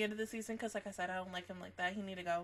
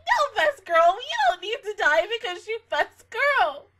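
pleading cry; 8 semitones higher, 8 dB louder than usual. Retold or imitated. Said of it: "you're like".

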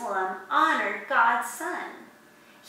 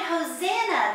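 raised, higher cry of praise; crowd's shout; 6 semitones higher, 3 dB louder than usual. A narrator's reading. The people greet Jesus entering town, shouted higher. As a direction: call it out as the crowd. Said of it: "The people shouted".

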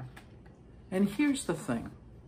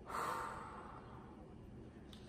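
quiet breathy exhale; deep calming breath; 18 dB quieter than usual. Shown as a demonstration. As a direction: let the breath out slowly. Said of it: "You model the calming technique".

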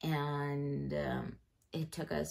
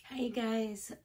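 morning voice; 7 semitones higher, 6 dB louder, slower than usual. Real, not acted. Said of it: "sorry I have that morning voice".

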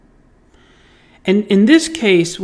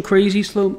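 ordinary delivery

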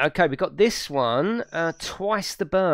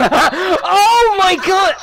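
loud, high, drawn-out exclamation; shrieking with laughter; 12 dB louder, much slower than usual. Real, not acted. Player reacting to game mishap, loud laughing shriek. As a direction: cry out, helpless with laughter.

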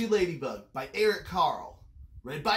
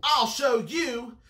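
high, loud, drawn-out threat; defiant grouchy ladybug; screeched threat; 7 semitones higher, 5 dB louder, slower than usual. A narrator's reading. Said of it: "screeched the grouchy ladybug".

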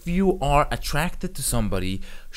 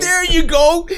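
much higher and louder, quick hype; cheering a friend's answer, loud and high; excited cheer; 10 dB louder, slower than usual. Real, not acted. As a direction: celebrate like your team just scored. Celebrating the contestant's choice.

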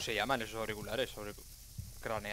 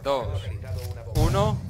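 loud; 6 dB louder, much slower than usual. Acted. Countdown ending in a shout.

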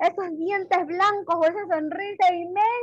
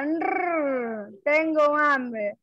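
hungry growl; 2 semitones lower, much slower than usual. Shown as a demonstration. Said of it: "se toca su barriguita".

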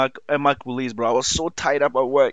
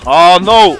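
raging gamer; 4 semitones higher, 12 dB louder than usual. Real, not acted. Sudden mistake in fight.

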